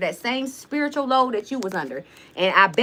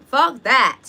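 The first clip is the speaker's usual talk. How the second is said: "Fuck that" punched much louder, higher; louder emphatic repeat; 4 semitones higher, 9 dB louder than usual. Real, not acted. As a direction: hit "Fuck" hard and loud the second time. Repeats dismissal, second time louder.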